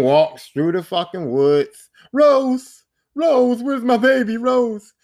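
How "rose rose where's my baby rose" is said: mock frantic calling, higher and louder. Ethan walking through the woods seeking his baby, calling out. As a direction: imitate a lost father shouting for his child.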